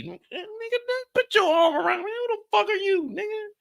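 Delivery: high pretend indignation at a hug; mock outraged. Imagining a stranger putting an arm around him, mock outraged. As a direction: squeal it high, offended by the touch.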